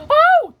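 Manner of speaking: very high, loud acted cry; alarmed "oh". Mommy Pig sees Daddy Pig's mishap, high alarmed cry.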